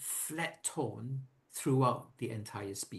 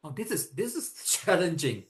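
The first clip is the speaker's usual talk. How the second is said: laughing; 5 semitones higher, 5 dB louder than usual. Real, not acted. Laughs while trying lip trill.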